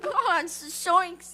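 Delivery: Shaggy voice. Pretend. Imitating Shaggy.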